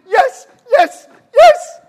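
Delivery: high, loud shouting like an eager donkey; wildly excited. Retold or imitated. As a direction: burst out, jumping to testify. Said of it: "I'm jumping".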